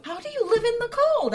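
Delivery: very high-pitched baffled question; mock incredulous curiosity. Imitating curious people asking an islander, mock incredulity. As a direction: ask as a naive, fascinated stranger.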